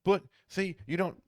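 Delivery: high, pushy salesman pleading; mock a telemarketer who won't quit; a high, whiny caller pressing on. Telemarketer cutting back in.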